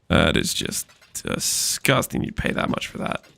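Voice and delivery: low, soft, near-whispered disgust; hushed disgust. Real, not acted. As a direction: let the voice drop away, appalled. Disgusted at a price paid.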